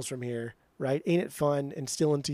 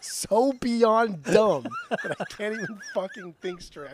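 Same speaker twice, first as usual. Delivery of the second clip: hammed-up fury at a dumb pick; mock outrage; 4 semitones higher, slower than usual. Ranting at co-host over his ranking, mock outrage. Heated fake-angry rant at co-host.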